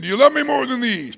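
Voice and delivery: loud, high-pitched pointed question; emphatic challenge. Acted. The Lord pointing at the fish, loudly challenging. Preacher acts out a possible reading.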